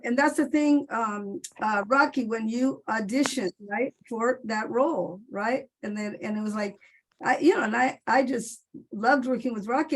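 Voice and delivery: ordinary delivery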